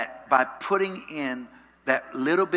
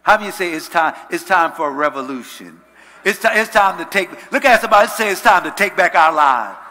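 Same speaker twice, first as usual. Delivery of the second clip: loud, full-force preaching; rally the congregation to say it with you; rallying preacher; 4 semitones higher, 12 dB louder, faster than usual. Real, not acted. Rallies the congregation.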